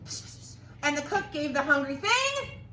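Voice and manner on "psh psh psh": soft unvoiced psh whisper. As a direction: whisper it secretly. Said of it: "the wise man whispered".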